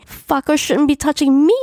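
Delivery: high, indignant mock shout; shouting back. A narrator's reading. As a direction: yell back in angry protest. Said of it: "I shouted at her".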